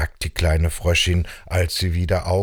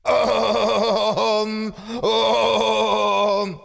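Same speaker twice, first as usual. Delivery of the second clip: high, bleating, wobbly Om; billy goat trying Om; 13 semitones higher than usual. Acted. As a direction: attempt calm but bleat it. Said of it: "das probier ich mal". (meckerndes, hohes Ziegenbock-Om)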